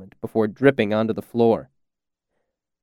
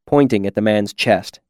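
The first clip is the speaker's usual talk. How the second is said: ordinary delivery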